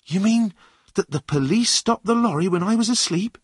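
high-pitched, surprised character voice; John, startled boy figure, questioning. Acted. Runaway asking the driver about the police stop, surprised. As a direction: ask in a high voice, taken aback. Young John's voice in the story.

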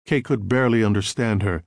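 ordinary delivery

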